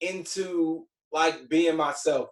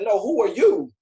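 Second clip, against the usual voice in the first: high, loud imitation of an outraged friend; incredulous challenge; 7 semitones higher, 8 dB louder than usual. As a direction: call out the fake, acting it out. Friend challenges him in retold quarrel.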